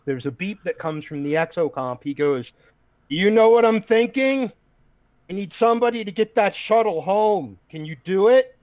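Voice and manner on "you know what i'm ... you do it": higher, louder, urgent in-character appeal; rally the robot to the mission; eager urging. Acted. Officer urgently proposes plan to Oakley.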